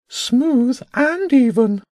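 high, drawn-out pigeon voice; bishop bird. Acted. Bishop bird admires James's drawing, high bird voice. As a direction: approve slowly in a high bird voice, leaning on "and".

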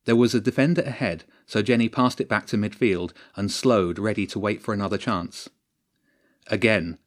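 plain reading voice

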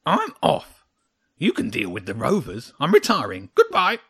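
loud, high, curt little creature; indignant parting shot; grumpy hedgehog, huffy. A narrator's reading. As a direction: quit in a huff, ending on a high burst.

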